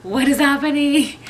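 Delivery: mock-panicked yelp mid-contraction; retold panic. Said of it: "I was so scared".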